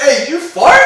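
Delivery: loud, high, teasing yell; hammed-up accusing shout; mock accusing shout. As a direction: call him out loudly, laughing at him. Teasing friend about farting in taxi.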